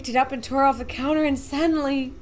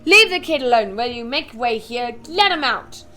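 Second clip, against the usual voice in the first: firm shout; 7 dB louder than usual. Acted. Shopkeeper clears crowd.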